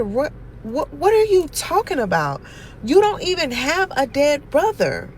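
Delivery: high, loud incredulous what-are-you-talking-about; stunned disbelief. Retold or imitated. Aunt to nephew who claims a dead brother, shocked and loud. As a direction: blurt it out, floored by the lie.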